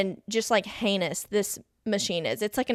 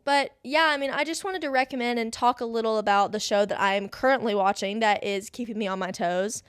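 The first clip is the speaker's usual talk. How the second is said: ordinary delivery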